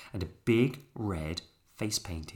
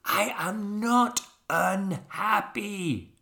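put-on slow, high, loud French-accented shout; 12 semitones higher, 7 dB louder, much slower than usual. A narrator's reading. Albert finally telling the toys, exasperated shout. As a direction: shout each word slowly in exasperation. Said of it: "he shouted".

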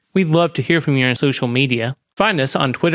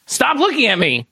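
higher, panicked shout at the shadow; panicked yell; 10 semitones higher than usual. Acted. Yelling at the shadow.

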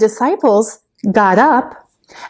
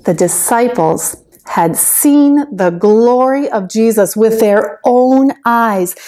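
ordinary talk, nothing marked

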